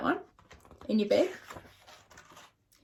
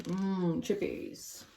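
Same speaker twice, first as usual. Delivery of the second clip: greedy seagull; 3 semitones lower, 4 dB quieter, much slower than usual. Acted. Seagull craves chips.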